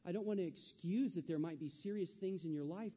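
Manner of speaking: ordinary delivery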